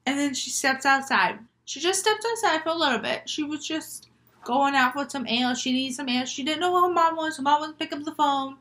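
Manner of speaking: plain reading voice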